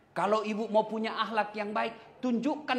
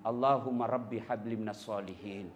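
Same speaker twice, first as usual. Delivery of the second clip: tender prayer; 9 semitones lower, 3 dB quieter than usual. Shown as a demonstration. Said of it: "kita usap kepalanya".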